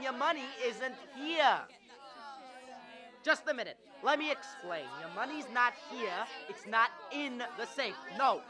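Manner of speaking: ordinary delivery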